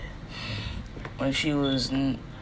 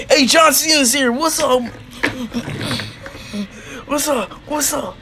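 high-pitched, punchy yells; excited challenge; 9 semitones higher, 3 dB louder than usual. Acted. Wrestlers greeting John Cena's arrival in the fight, excited shouting. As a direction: call out the newcomer, pitched way up. New arrival in acted brawl.

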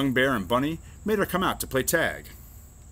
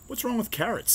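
high, mildly offended bunny; hurt sniff; 11 semitones higher than usual. A narrator's reading. Bunny defends carrots to Mouse, high hurt little protest. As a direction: pitch it up, a touch huffy. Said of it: "sniffed Bunny".

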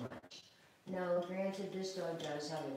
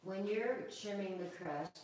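usual speaking voice

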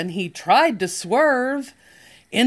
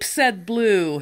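plain reading voice

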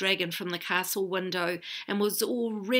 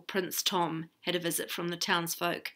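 plain reading voice

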